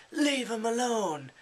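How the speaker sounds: high, loud retold yell; retold shout. Fyedka yells at the tormentors to leave him alone. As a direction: bark it out to defend him. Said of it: "Fyedka's like".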